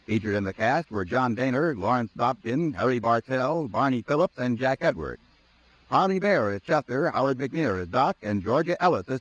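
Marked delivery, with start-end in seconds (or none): none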